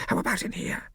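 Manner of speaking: high unvoiced whisper. A narrator's reading. Hornet searching the dark house, whispered. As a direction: ask it in a hushed breath. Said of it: "Hornet whispered".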